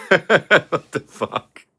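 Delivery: slow, laughing "what the fuck"; laughing disbelief. Real, not acted. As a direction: laugh it out in shock. Laughing at subscriber drop.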